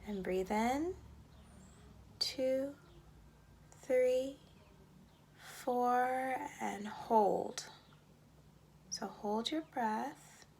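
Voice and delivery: slow, measured meditation voice with long pauses; unhurried breathing-exercise lead, words spaced out; guided meditation. Acted. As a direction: count the breaths evenly and guide them into calm.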